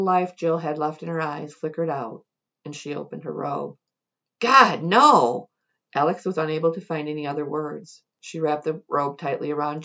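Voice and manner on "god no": sharp, loud cry of horror; horrified cry. A narrator's reading. Alex recoiling at what Jill reveals, horrified loud cry. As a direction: blurt it out in shock.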